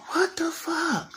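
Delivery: very high, slow squeal of disbelief; high, drawn-out exasperated whine. Acted. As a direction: stretch it out way up high. Exasperated at everyone's fussing.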